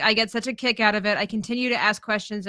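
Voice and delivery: ordinary talk, nothing marked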